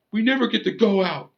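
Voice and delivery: high-pitched mock whiny voice; mocking whine. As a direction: whine it like a nagging child. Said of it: "like when you whine".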